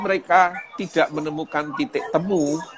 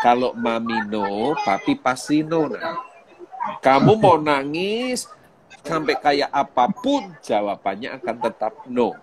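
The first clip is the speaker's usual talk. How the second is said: put-on slow, firm, deliberate refusal to a crying child; father refusing a whining child, slow and firm. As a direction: lay down the rule, each word weighted. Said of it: "saya akan berkata".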